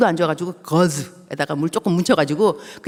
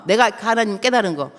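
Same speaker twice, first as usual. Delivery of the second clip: ordinary delivery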